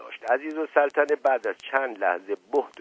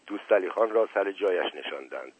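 ordinary delivery

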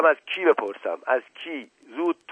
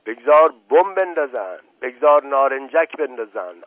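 furious shout; 7 dB louder than usual. Acted. Uncle shouting in rage.

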